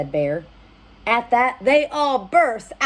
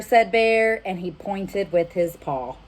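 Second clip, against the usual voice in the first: ordinary delivery, nothing marked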